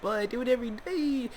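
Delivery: high put-on whine of a complaining kid; whiny kid self. Student whining back at his sensei, high complaint. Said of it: "I'm like".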